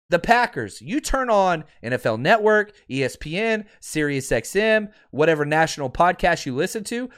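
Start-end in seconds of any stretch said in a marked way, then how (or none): none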